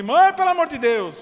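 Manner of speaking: new mother pleading with her own mother, exhausted; desperate plea. Retold or imitated. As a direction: beg your mother for help.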